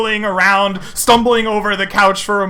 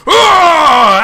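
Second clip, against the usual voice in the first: loud, higher mock roar; dragonborn roar; 8 semitones higher, 9 dB louder than usual. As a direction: roar as they charge in. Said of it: "the copper dragons go".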